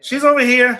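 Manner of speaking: loud, high, bright show-and-tell; excited pointing out; excited call. Real, not acted. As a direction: point her out with a burst of energy. Showing the dog, loud excited.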